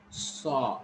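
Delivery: "Saw" with a drawn-out aw; long vowel. Shown as a demonstration. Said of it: "is long A".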